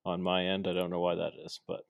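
ordinary talk

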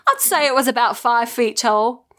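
put-on shaky stab at an English accent; attempted English dialect. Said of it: "Should I try a dialect for this?".

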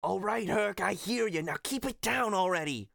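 exasperated trainer, pitched high; Phil. Acted. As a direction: shush an over-eager pupil. Phil answering Hercules.

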